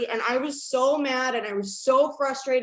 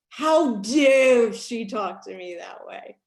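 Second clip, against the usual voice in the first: slow, louder indignant how-dare-she; mock outrage; 6 dB louder, slower than usual. Recalling the fight with Julie, mock outraged. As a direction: play up your old offended self. Retelling her anger.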